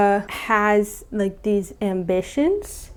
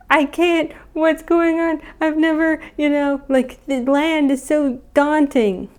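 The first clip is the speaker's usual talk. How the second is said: high, halting, overwhelmed voice; mock freaking out; 7 semitones higher than usual. Sea monster pulled onto land, mock freaking out. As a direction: play a kid panicking, pitched up. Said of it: "he's, like, freaking out".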